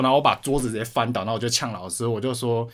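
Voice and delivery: ordinary talk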